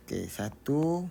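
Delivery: ordinary talk, nothing marked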